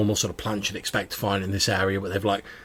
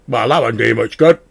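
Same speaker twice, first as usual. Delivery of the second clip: louder, otherworldly talking sword; 2 semitones higher, 10 dB louder than usual. Acted. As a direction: voice a wry magic sword. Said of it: "you hear the voice of the sword".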